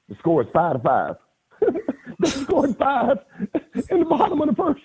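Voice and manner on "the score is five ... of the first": very high, laughing through the words; squeaky giggling incredulity; laughing disbelief. Real, not acted. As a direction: crack up as you tell it. Laughing at absurd score.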